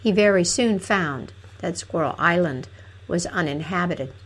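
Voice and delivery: ordinary delivery, nothing marked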